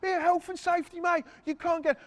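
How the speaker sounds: high-pitched imitation of a ranting worker; lightly hammed-up health and safety rant; retold site worker, voice pushed high; mock angry bloke. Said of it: "This bloke's going mad".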